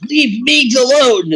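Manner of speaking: high, drawn-out mock pleading; stretched-out, high-pitched mimicry; mimicked plea. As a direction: imitate an insistent defender, slow and high. Mimicking friends defending Meigs again.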